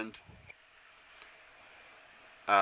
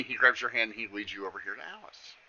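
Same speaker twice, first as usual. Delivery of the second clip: ordinary delivery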